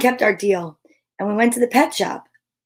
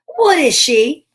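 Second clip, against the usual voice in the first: high, loud, slow question; Morris asking; 6 semitones higher, 7 dB louder than usual. A narrator's reading. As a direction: demand the answer from the deer. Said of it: "Morris asked the deer".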